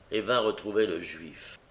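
usual speaking voice

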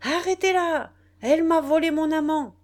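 loud, high cry for help; a woman screaming to stop a thief; shouted accusation. A narrator's reading. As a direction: shout it across the crowd. A woman cries out in public.

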